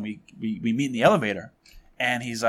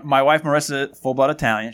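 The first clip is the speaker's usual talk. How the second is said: normal talking voice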